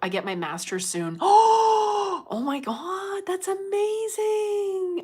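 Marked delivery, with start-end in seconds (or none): 1.2-2.3 sharp high excited gasp. Real, not acted. Good news from a viewer. 2.3-5.0 high, slow, gushing delight; thrilled. Real, not acted. Reacting to viewer's good news.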